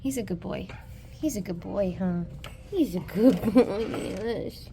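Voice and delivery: soft, low dog praise; praising the dog. Real, not acted. Owner praising her dog, soft and low.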